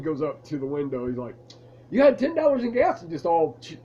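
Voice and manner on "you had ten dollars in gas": much higher, louder, beaming service voice; chipper and happy. Retold or imitated. Clerk greeting the scared customer, chipper and happy. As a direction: sing it out with a big smile. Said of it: "chipper and happy and big smile".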